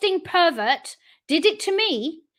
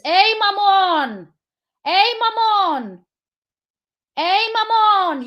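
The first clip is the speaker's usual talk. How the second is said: loud, slow, drawn-out call; 6 semitones higher, 5 dB louder, much slower than usual. Real, not acted. Hailing her cousin in Sweden, a loud slow call. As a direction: call across to him, stretching each word.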